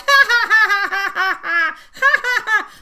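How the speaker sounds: high, loud cackling ha-ha-ha; bird's mocking laugh. Imitating her mom's bird laughing at her, high loud cackle. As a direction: mimic a parrot laughing at you. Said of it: "he's like".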